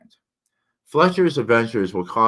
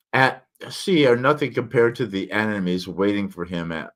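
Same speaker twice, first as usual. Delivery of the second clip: ordinary talk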